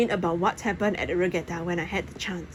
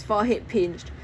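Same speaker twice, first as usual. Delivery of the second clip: usual speaking voice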